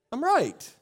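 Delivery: tossed off, self-assured; smug. Retold or imitated. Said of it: "we say things like".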